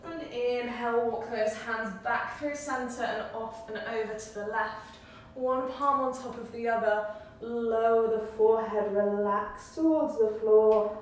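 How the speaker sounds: slow, drawn-out yoga teacher voice; unhurried cueing with long pauses; yoga guidance. Real, not acted. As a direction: guide them through each breath, stretching the key words. Guides a yoga breathing practice.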